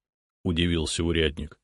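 plain reading voice

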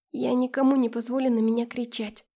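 usual speaking voice

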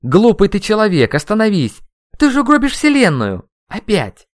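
high, loud desperate yelling; full-voice shout. A narrator's reading. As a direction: shout a frantic warning. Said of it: "крикнул я во весь голос". (отчаянный крик во весь голос)

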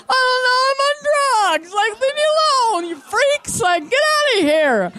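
very high, loud, drawn-out whine; mock-hysterical squealing protest; drugged panicked wail. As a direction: wail to be left alone, hammed up. Drugged patient wailing at students.

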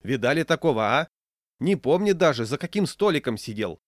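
high, loud public denunciation; rally-speaker voice. A narrator's reading. Old woman turns the diners against him, rally-speaker voice. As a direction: denounce him to the whole room. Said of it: "митинговым голосом обратилась к прочим старушка".

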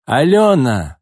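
loud call across the yard. A narrator's reading. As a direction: holler the girl's name. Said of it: "завопила вдруг Нина".

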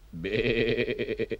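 goat bleat. Shown as a demonstration. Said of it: "Luister maar".

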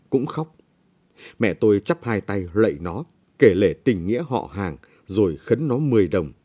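plain reading voice

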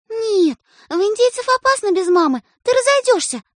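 high, loud refusal; forbidding sister. A narrator's reading. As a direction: shut the idea down.

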